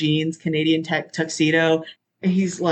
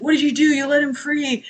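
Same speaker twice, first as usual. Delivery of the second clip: outraged agent; 9 semitones higher than usual. Retold or imitated. FBI agent upset at Johnny.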